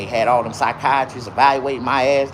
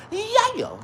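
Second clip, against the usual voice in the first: squeaky yeah. Pretend. Squeaky cartoon voice continues.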